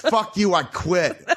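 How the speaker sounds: high, laughing mock yell; mock angry outburst. Husband imitating wife quitting, half-laughing yell. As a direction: toss off a fake tantrum. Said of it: "And she goes".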